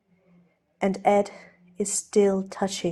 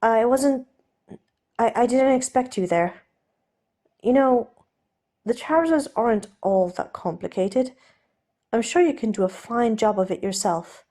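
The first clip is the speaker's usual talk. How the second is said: raised, stammering gentleman voice; flustered Steed; 4 semitones higher, 3 dB louder than usual. Acted. Steed dodging helping Ed with trousers, flustered stammer. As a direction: backpedal in a hurry, tripping over words.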